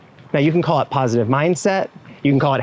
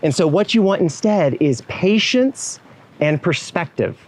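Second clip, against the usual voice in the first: normal talking voice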